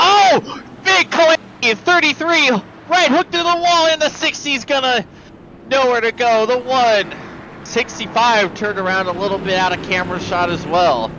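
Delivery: high, urgent play-by-play shout; excited crash call. Real, not acted. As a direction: call the wreck as it happens. Crash called live on air.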